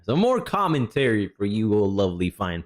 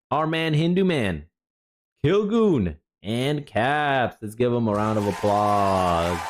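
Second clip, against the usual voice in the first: announcer-style welcome; 8 semitones higher than usual. Acted. Host welcoming viewers back in studio, announcer style.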